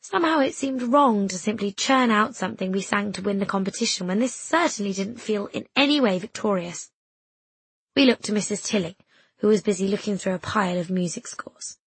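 ordinary delivery, nothing marked

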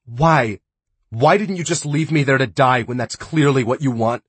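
high, loud desperate demand; bitter shouting. Acted. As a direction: throw it back at him in anguish. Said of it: "Dre asked".

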